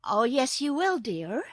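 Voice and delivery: put-on insistent little girl. A narrator's reading. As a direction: firmly overrule the kitten. Girl overrules the kitten.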